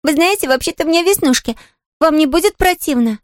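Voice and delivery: put-on high, apologetic child's voice; rueful little girl. A narrator's reading. Girl warning the invalid about her freckles, rueful. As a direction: warn her in a high girlish voice. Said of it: "с печальным смехом предупредила".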